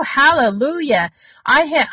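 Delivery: ordinary delivery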